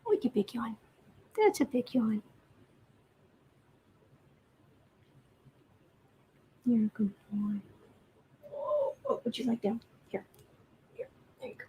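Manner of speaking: quiet tender pet talk; soft cooing. Real, not acted. Fussing over the yawning kitty, soft cooing. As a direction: coo softly at the yawning kitten.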